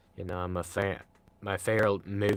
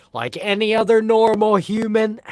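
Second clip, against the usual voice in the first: mock human voice; 13 semitones higher, 10 dB louder than usual. Mock robot human claim.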